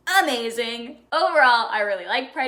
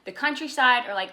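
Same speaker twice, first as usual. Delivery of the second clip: ordinary talk, nothing marked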